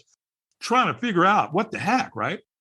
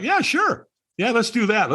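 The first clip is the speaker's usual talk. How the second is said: high-pitched, sarcastic yeah sure; mock-eager agreement; 7 semitones higher than usual. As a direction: play along as if keen. Retold sarcastic thought, agreeing to watch.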